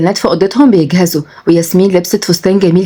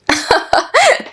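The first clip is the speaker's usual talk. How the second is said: high-pitched teasing laugh; giggling at grandad; high giggle; 3 dB quieter than usual. Acted.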